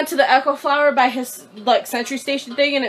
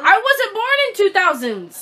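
high-pitched defensive retort; indignant protest; 8 semitones higher than usual. Real, not acted. Bickering over being a millennial.

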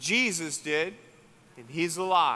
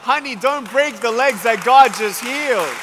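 mock-alarmed call; 6 semitones higher, 11 dB louder than usual. Telling jumping woman to calm.

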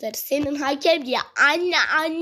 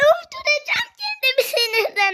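high, raised rage cry; exasperated shout; 11 semitones higher than usual. Real, not acted. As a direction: wail it out in pure frustration. Rage at the match.

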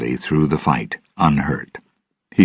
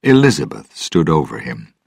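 ordinary delivery, nothing marked

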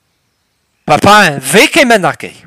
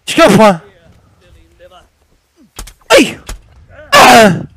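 mock panicked yelps; 3 semitones higher, 6 dB louder than usual. Yelping apologies in game.

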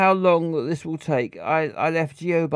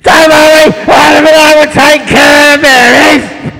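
eager shout; 10 semitones higher, 15 dB louder than usual. Acted. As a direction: shout it out keenly. Voicing an eager game character.

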